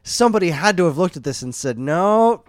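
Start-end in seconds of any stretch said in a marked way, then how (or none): 1.8-2.4 imagined objection. Retold or imitated. Voices someone objecting to film scenes.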